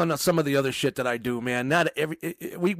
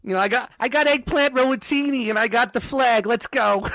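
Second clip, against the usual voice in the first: Italian-American voice; 6 semitones higher than usual. Pretend. Mock Italian-American bragging.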